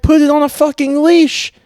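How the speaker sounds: high, loud mock yell; retold shout. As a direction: shout it like you've lost patience. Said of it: "And I was like".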